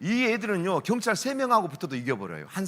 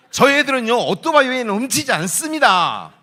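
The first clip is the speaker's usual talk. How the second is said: high, booming declaration on the students' behalf; loud proclamation; 5 semitones higher, 11 dB louder than usual. Acted. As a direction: proclaim it loudly, like a comic defence. 새 아이들을 대변하는 큰 외침. (크고 높게 외치듯 선언)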